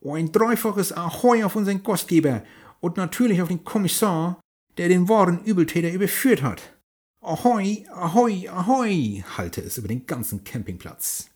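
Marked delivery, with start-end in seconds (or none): 0.1-9.2 high, loud pirate captain cheer; old sailor. Acted. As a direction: bellow a triple ahoy to the crowd. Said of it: "Der Kapitän rief laut in die Runde".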